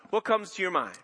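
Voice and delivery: ordinary delivery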